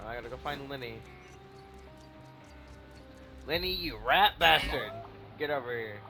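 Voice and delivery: usual speaking voice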